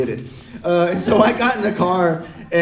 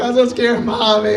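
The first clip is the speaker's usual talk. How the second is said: higher, hammed-up little-kid crying; tearful mock wail; 5 semitones higher, 4 dB louder than usual. Scared kid finally tells his mom, tearful mock wail. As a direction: cry out to a parent through pretend tears. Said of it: "all the tears just flow".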